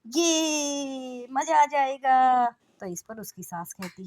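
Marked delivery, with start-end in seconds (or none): 0.1-2.4 high, loud, slow squeal of joy; excited scream. Acted. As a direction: cry out with delight at the news. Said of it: "जोर से चीखती है".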